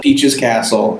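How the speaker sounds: ordinary delivery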